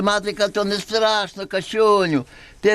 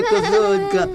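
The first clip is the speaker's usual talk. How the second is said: cooing; slower than usual. Acted. Cooing to goat.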